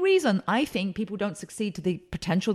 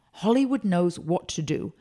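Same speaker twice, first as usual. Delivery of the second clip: normal talking voice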